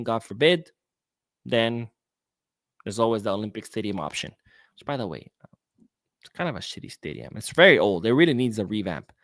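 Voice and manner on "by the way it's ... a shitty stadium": ordinary talk, nothing marked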